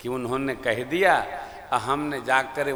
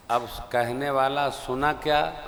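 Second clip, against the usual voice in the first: ordinary talk, nothing marked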